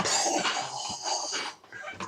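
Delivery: long weary breathy sigh; exhausted sigh. Retold or imitated. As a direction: let out a defeated sigh. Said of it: "he comes home and is just like".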